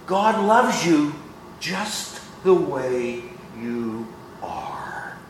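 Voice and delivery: mocking a soft feel-good preacher; soft mock reassurance. Preacher mocking soft feel-good churches, hushed syrupy coo. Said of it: "Here's what you will hear in many churches".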